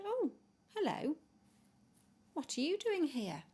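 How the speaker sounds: a surprised, friendly small bird; penguin voice. Acted. Peppy the penguin greeting the fallen toy, high and friendly.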